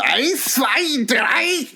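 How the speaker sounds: high shouted German count; Hitler shouting. Retold or imitated. Hitler opening his best man speech, high shouted count. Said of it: "He gets on and goes".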